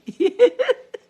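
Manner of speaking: a high, loud burst of laughter. Real, not acted. Laughing at being up all night.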